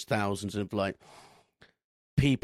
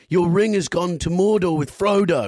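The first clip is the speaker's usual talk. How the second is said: louder and higher, losing patience, stress on Mordor; exasperated caller repeating himself, much louder; impatient repeat; 8 semitones higher, 10 dB louder than usual. Retold or imitated. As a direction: bark it out like you're fed up repeating yourself. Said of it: "lost his patience and went".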